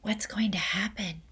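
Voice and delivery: breathy unvoiced whisper; frightened schoolgirl whisper. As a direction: whisper it nervously to a friend. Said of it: "Lavender whispered".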